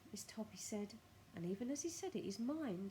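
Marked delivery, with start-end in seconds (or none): none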